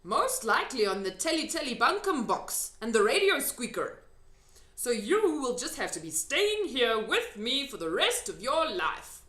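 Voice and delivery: lightly voiced gentle giant; big friendly giant. A narrator's reading. Giant continues speaking.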